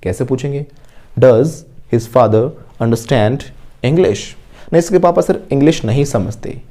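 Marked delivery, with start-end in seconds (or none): 1.2-4.2 very slow, word by word, for students to repeat; slow model sentence. Shown as a demonstration. Teacher modelling an answer for the class, very slowly. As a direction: spell each word out slowly like a dictation.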